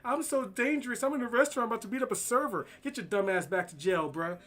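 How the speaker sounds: mock the tough guy as a silly show-off; mocking impression. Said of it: "I'll continue mocking them like".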